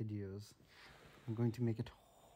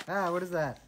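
startled; 6 semitones higher, 8 dB louder than usual. Real, not acted. Startled by spider in game.

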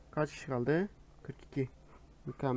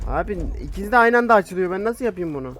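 fast, loud and high panic; frantic; 5 semitones higher, 8 dB louder, faster than usual. Real, not acted. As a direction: rush it out in alarm. Two obstacles opening at once.

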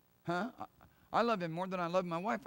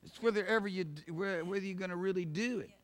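ordinary talk, nothing marked

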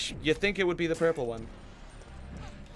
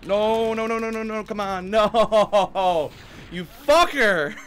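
loud, high laughing-angry outburst; frustrated yell; 4 semitones higher, 5 dB louder, slower than usual. Real, not acted. Player cursing the boss that just killed them, loud and exasperated. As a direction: blow up at the boss, half laughing.

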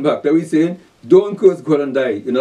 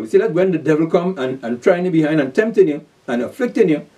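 ordinary delivery

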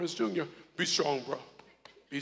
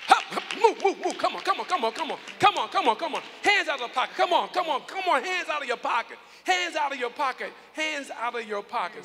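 loud, higher playful urging; urging shouts; 5 semitones higher, 6 dB louder than usual. Real, not acted. Pastor hustling the young man to the front, shouting. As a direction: hurry him up like a coach. Pastor hurrying the youth along.

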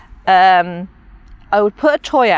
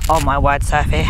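lower, lightly voiced exasperated dog; the dog rolling its eyes at Sophie; dog's thoughts; 7 semitones lower than usual. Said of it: "she's like".

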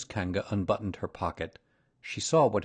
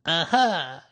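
higher, louder small-animal aha; not-very-good "aha" in a raised voice; weak attempt at triumph; 8 semitones higher, 6 dB louder than usual. A narrator's reading. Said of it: "as well as he could after his terrifying journey".

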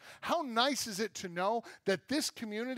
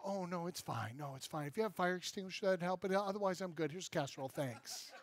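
much softer, calm shrug of a voice while on fire; unbothered Dutch person; 8 dB quieter than usual. Retold or imitated. Preacher mocks a burning Dutch neighbor, much softer and unbothered. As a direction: play it down as if nothing is wrong. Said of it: "they're like".

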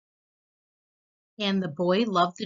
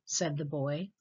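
ordinary delivery

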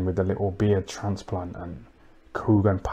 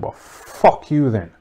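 retold insult; 5 semitones higher, 6 dB louder, slower than usual. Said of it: "So I said".

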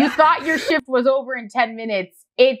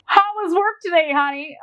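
higher-pitched mock domestic greeting; lightly voiced spouse; 5 semitones higher than usual. Partner greeting honey after work, in a lightly put-on higher voice. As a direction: play at asking your partner about their day.

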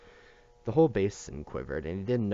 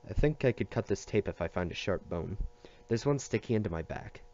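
plain reading voice

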